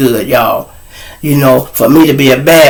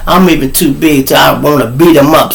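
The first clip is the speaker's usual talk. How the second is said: usual speaking voice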